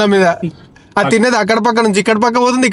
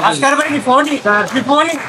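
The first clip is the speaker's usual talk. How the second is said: high excited call across the room; 7 semitones higher than usual. Real, not acted. Calling out to Bhaskar at his birthday dinner, high and excited. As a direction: pitch it up to grab his attention.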